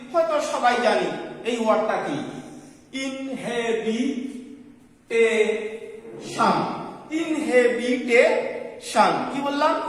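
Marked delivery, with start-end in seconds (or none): 3.0-6.6 ইনহেবিটেশন very slowly, each syllable held apart; broken into syllables. Shown as a demonstration. Teacher showing students a big word, ইনহেবিটেশন broken into slow syllables. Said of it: "ভেঙে ভেঙে উচ্চারণ করতে হবে". 7.2-9.1 ইনহেবিটেশন slowly, in separate syllables with short gaps; broken into syllables. Shown as a demonstration. Teacher repeating a big word for students, ইনহেবিটেশন in slow separate syllables. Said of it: "ভেঙে ভেঙে উচ্চারণ করতে হবে".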